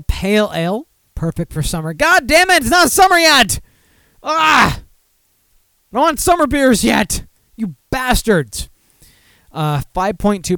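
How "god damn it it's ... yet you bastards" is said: high, loud hammed-up outrage; exasperated rant. Host railing at breweries over early summer beers, hammed-up shouting. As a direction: yell in comic exasperation.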